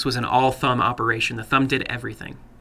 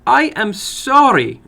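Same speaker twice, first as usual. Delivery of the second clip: higher, slow, apologetic woman's voice; apologetic older woman; 10 semitones higher, 7 dB louder, slower than usual. Acted. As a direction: plead sorry as the mother figure.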